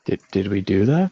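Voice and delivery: hushed surprised whisper. Real, not acted. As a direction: whisper it to your friends.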